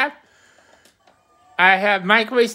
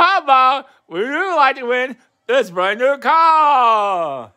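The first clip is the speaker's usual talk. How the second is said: big, drawn-out prize announcer voice; booming prize announcer; 3 semitones higher, slower than usual. Pretend. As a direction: boom out the prizes like a game show announcer.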